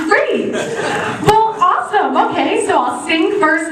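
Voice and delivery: high-pitched, thrilled squeal of agreement; giddy delight. Retold or imitated. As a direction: gush eagerly, barely containing the excitement. Retells her delighted reply to director.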